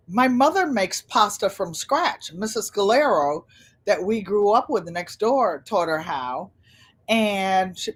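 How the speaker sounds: ordinary talk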